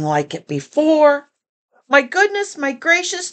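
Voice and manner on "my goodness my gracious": high, amazed exclaiming; a crowd's delighted mock amazement; shouted. A narrator's reading. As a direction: gush with wonder. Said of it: "they shouted".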